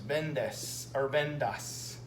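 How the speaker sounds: Vendes or vendas said very slowly; slow contrast demo. Shown as a demonstration. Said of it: "I'm gonna change this to A S".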